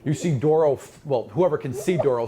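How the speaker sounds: ordinary delivery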